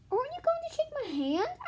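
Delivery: put-on teasing kid. John to Louis, teasing high giggle. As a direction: taunt him with a giggle.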